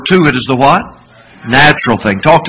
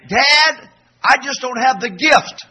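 put-on high-pitched kid making excuses; imitated son; 10 semitones higher, slower than usual. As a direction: mimic a boy begging off, well pitched up. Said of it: "he looked at me and said".

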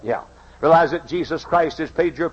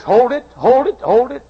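louder, higher command to stop; raised voice calling a halt; stern mind; 11 semitones higher, 8 dB louder than usual. Acted. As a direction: rein the heart in hard. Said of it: "Mind says".

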